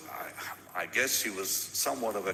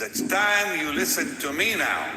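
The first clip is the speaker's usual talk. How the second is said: a nurse laying down the law, raised voice; firm loud order; 4 semitones higher, 7 dB louder than usual. Retold or imitated. Male nurse ordering the sick preacher, firm and loud. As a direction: tell him firmly to listen now.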